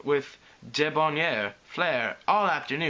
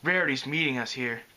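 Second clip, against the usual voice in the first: ordinary delivery, nothing marked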